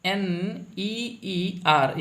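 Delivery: "ई एन जी आई एन ई ई आर" spelt letter by letter, very slowly; slow spelling. Shown as a demonstration. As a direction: spell it out for the class. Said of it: "engineer". (अक्षर-अक्षर धीरे से spelling)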